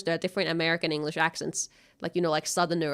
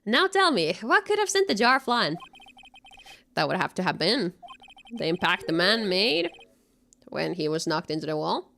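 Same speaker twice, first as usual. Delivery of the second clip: lightly voiced lawyer questioning a witness; courtroom lawyer; 4 semitones higher than usual. Streamer reading the lawyer's lines in court, lightly voiced. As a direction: press the point like a prosecutor. Reads game courtroom dialogue aloud.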